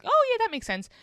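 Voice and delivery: high, loud bright "oh yeah"; forced agreement. Retold or imitated. To the boyfriend, loud high mock-cheerful agreement. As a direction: chirp it out far too eagerly. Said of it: "and I was like".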